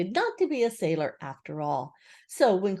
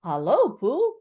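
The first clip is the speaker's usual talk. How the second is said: loud, raised call to a friend; joyful greeting; 6 semitones higher, 6 dB louder, slower than usual. A narrator's reading. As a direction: call out happily.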